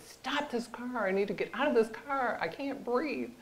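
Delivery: restrained re-enactment of panicked yelling; retold panic. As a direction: plead to get out, high but held back. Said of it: "I was screaming and yelling".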